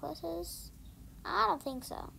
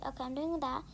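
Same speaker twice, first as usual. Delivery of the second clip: ordinary talk, nothing marked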